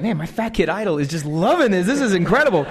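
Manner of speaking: marvel, elated, at your idol's approval; thrilled. Retold or imitated. Thrilled that idol loves his joke.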